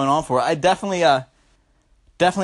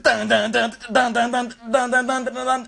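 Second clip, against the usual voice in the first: chanting the techno beat dun dun dun; mouth-made techno beat; beat imitation; 5 semitones higher than usual. Shown as a demonstration. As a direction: thump out the bass like a rave track. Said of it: "It's like".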